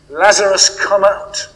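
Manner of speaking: loud, high commanding call; cry out. A narrator's reading. Jesus calls Lazarus from the grave, loud command. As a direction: call the dead man out of the tomb. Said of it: "Jesus cries out".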